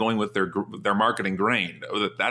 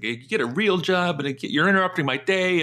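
raised, higher pitched mock tirade at a cold caller; a retold phone outburst, pitched up; mock angry rant; 9 semitones higher than usual. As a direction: imitate a rude guy berating a caller. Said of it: "and freaking out".

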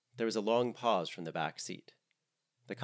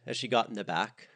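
plain reading voice

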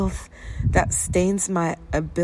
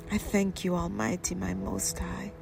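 fading to whisper; 9 dB quieter than usual. Acted.

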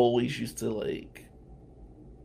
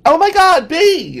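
much higher and much louder, gushing; excited squeal; 18 dB louder, slower than usual. Retold or imitated. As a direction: squeal it like you just spotted a celebrity. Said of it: "And she's all excited".

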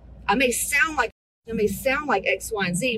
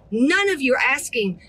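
ordinary talk

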